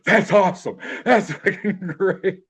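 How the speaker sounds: high, excited mock praise, laughing through it; high, excited retold praise; thrilled director. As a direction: gush like a delighted director. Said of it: "the director went".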